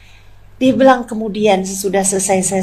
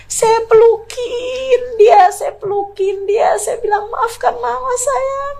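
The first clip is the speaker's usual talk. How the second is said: high voice breaking into sobs; crying; 13 semitones higher, slower than usual. Real, not acted. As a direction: weep while telling the embrace. Hugging daughter while weeping. (suara tinggi, menangis sambil bercerita)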